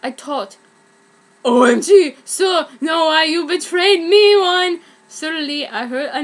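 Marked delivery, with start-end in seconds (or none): 1.4-4.8 betrayed shout. Acted.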